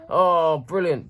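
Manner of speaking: a high, loud burst of delight; thrilled at the gift; delighted cry. Real, not acted. Opening a birthday gift box, a thrilled cry.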